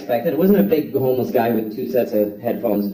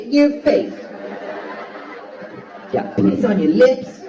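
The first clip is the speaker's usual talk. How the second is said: high, far put-on voice hurling insults; shrill mock bully voice; high squeaky taunting voice; 11 semitones higher than usual. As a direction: squeal insults at the toilet through laughter.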